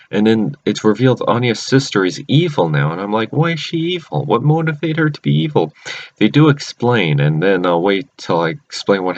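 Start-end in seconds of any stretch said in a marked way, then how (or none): 3.3-5.7 puzzled outcry. Retold or imitated. Reacting to evil sister reveal.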